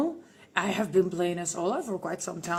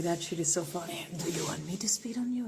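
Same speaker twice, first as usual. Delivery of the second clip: ordinary delivery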